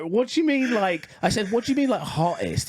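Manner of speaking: high-pitched, incredulous retort; baffled questioning. Retold or imitated. Desk mate questioning coworker's odd remark, high and baffled.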